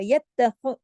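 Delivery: यत्तख with a thick rasped ख; scraping kha. Shown as a demonstration. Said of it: "खा को खराश के साथ". (यत्तख, खा खराश के साथ)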